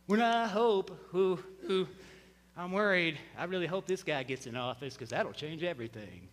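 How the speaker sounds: soft, low, nervous mumble of a worrier; mock-fretful, half-swallowed hoping; worried voice. Pastor mocking hope in politics, low and fretful.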